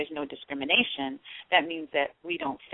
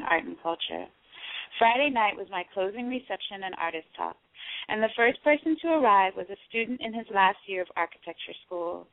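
a slightly raised, steady reading voice; reading aloud; 4 semitones higher than usual. Acted. Reading her own story aloud to the interviewer, steady read-aloud voice. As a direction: read your own memoir aloud to the host.

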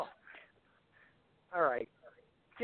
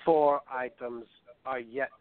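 gloomy German narrator, lower and unhurried; Werner Herzog; 6 semitones lower than usual. Acted. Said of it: "this is, uh, Werner Herzog".

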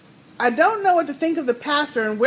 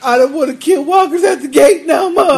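louder, hammed-up crying whine; mock whining; 6 dB louder than usual. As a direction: pretend to blubber and complain, half laughing. Parodying a character's whining.

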